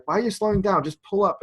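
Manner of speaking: retold yelling from an exasperated passenger; imitated yelling. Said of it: "Deming would be yelling at me".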